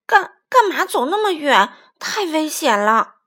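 loud, high scolding with a stammered start; worried father's outburst; angry shouting. A narrator's reading. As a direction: scold out of alarm, raising your voice. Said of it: "霸王龙生气地大声叫着".

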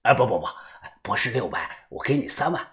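low, soft, reluctant-sounding offer; through gritted teeth. A narrator's reading. Old man raises his bid to a young man, tight low voice. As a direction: force it out quietly through clenched teeth. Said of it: "面色涨红， 咬着牙说道".

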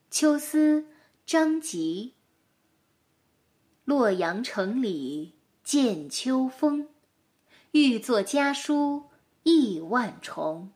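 slow, measured poem reading; poem recitation. Acted. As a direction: recite each line slowly and evenly. 朗读古诗. (缓慢朗诵古诗)

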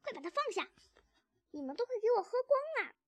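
high, loud accusing squeal; rat's shriek. A narrator's reading. Rat catches the thieves drinking, shrieking. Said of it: "老鼠尖叫道".

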